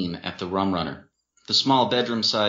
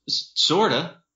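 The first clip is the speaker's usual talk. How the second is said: halting, stammered admission; trying to compose himself; 8 semitones higher, 4 dB louder, slower than usual. A narrator's reading. Man composing himself after crying, halting. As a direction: stumble into it, pitch lifted. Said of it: "Winston tried to compose himself".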